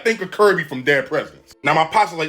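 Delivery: ordinary delivery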